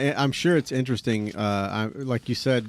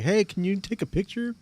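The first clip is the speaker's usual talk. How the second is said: eager fan voice; 9 semitones higher than usual. Retold or imitated. Imitating fans asking for photos.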